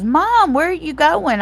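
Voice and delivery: high, loud imitation of an alarmed son; alarmed calling out. Son calls after fleeing mother.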